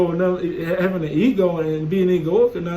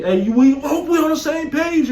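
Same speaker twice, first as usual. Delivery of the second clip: imitating yelling manager; 8 semitones higher, 4 dB louder than usual. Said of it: "He kept yelling at me".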